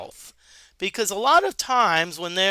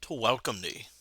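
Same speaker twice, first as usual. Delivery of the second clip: stately wizard; 10 semitones lower, 11 dB quieter than usual. Acted. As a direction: speak low and unhurried like an old sage. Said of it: "He says".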